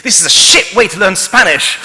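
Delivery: loud, fed-up outburst, imitated; angry complaint. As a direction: snap it like an annoyed student. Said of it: "turn on me after a few minutes and say".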